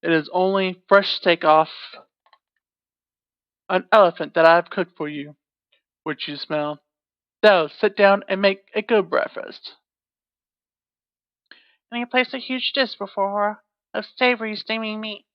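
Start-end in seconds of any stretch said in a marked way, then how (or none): 0.0-9.8 loud giant scolding. Acted. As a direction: scold her like a huge brute. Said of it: "in his loud tones".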